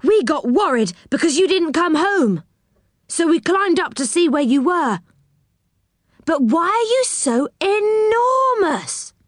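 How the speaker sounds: high, agitated character voice; astonished cry. A narrator's reading. Rick explaining, then gaping at their size, high astonishment. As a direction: build to high, stretched-out amazement. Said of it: "cried Rick".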